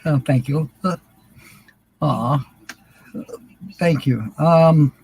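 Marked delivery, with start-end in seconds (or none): none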